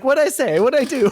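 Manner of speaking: high, puzzled mock voice; confused guy. Retelling the clueless character's reaction, high baffled voice. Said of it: "And he's like".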